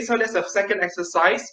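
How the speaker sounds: normal talking voice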